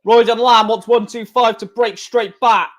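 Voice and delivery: normal talking voice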